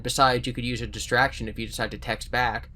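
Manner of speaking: plain reading voice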